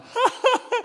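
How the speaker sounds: high, loud mock laughter; imitated laughing fit. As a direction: burst into exaggerated high laughter. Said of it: "Ola fängt an zu lachen". (übertrieben hohes Gelächter)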